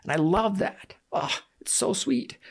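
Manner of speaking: high voice catching with real emotion; choking up. Real, not acted. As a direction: fight back a lump in the throat. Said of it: "Sorry, I'm choking up".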